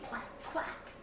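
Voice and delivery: short, husky duck quacks; two light, toneless quacks. A narrator's reading. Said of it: "called the duck".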